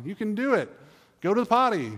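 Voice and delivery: put-on high, bright-pitched cheering on a toddler; encouraging parent. Parent urging a toddler onto the potty, high and loud. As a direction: cheer a little kid to the potty.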